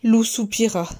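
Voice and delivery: ordinary delivery, nothing marked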